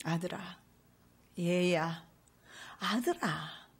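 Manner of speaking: a loving father softly calling "아들아". Acted. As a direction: call him gently like a loving father. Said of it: "부드러운 음성으로 사랑하는 아들을 부름".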